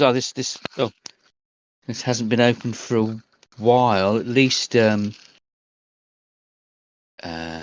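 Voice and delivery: put-on an old clerk counting the years; older bank manager. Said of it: "He goes".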